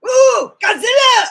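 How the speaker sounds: very high, loud alarmed shout; Japanese movie cry. Shown as a demonstration. Comparing which dub sounds better, high shout. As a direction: shriek it like a Japanese monster-movie extra. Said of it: "What sounds better?".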